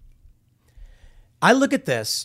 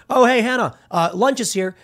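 raised, higher call across a film set; 7 semitones higher, 4 dB louder than usual. Retold or imitated. Crew member calls the armorer over for lunch, raised mock call. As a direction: shout over that lunch has arrived. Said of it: "someone yells over".

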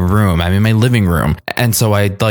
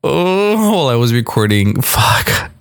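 startled; 5 semitones higher than usual. Real, not acted. Startled by sound on recording.

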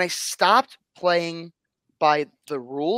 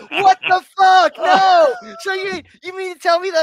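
shocked shout; 10 dB louder than usual. Retold or imitated. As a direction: yell it in outrage. Retold shock at being typecast.

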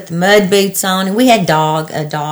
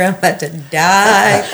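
laughing. Real, not acted. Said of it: "laughing while recalling panic".

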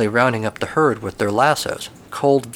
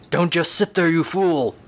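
high, grumpy commanding horse; a grumpy horse snapping at his rider; 6 semitones higher than usual. Acted. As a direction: bark orders like a cranky horse. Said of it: "this new horse commanded".

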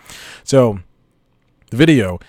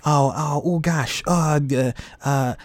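higher, halting fumble for the answer; stumped contestant; 4 semitones higher than usual. Acted. Guy auditioning for Beat Shazam, acting stumped and flustered. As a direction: act out searching frantically for a song title. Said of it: "He goes".

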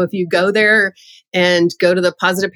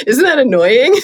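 laughing while asking, a bit louder; 5 semitones higher, 5 dB louder than usual. Real, not acted. Laughing at whining kids.

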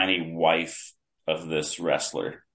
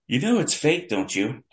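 higher-pitched needling mock question; mimicking a skeptic; 6 semitones higher than usual. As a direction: imitate a know-it-all challenging a fan.

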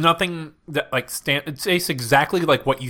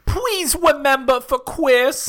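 pleading; 13 semitones higher, 3 dB louder, much slower than usual. Acted. Begging co-host to remember.

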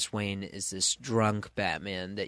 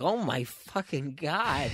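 drawn-out, higher-pitched reaction of disbelief; stunned disbelief; 5 semitones higher than usual. Retold or imitated. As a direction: react as if floored by a plot twist. Reader's stunned reaction to the plot.

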